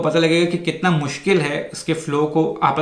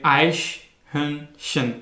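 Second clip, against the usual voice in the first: आइशहर्नशन very slowly, broken into three parts; divided into syllables; 3 dB quieter, much slower than usual. Shown as a demonstration. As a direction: split आइशहर्नशन syllable by syllable, with clear pauses. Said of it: "इसको अगर आप divide करें".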